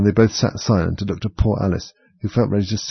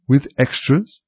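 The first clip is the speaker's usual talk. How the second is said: raised, tentative question; a little anxious; 6 semitones higher, 3 dB louder, slower than usual. A narrator's reading. Mock Turtle asks Alice about her school, a little anxiously. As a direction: ask with slight worry. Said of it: "a little anxiously".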